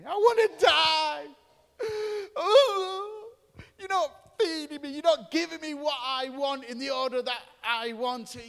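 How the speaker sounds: high, loud, mock-crying wail; whining Jonah. Jonah throwing a wobbler at God, high mock-crying wail. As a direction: whine like a toddler tantrum. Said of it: "Jonah was like this".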